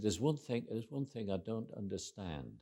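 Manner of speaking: softly imitated Northern English country accent. Friend's mum puzzled by Beverly Hills, in North Country accent. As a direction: mimic an older mum's North Country accent, lightly. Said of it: "North Country accent like that".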